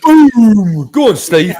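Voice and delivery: loud, high whoop of delight; shouted hype for a mic-drop moment; excited cheer. Real, not acted. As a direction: cheer him on like a goal went in. Cheering a mate's point.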